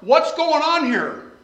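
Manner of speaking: loud, high, desperate plea to the Lord; preacher voicing an anguished prayer, loud and high; crying out to God. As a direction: cry out, questioning God. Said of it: "the evidence of the burden is".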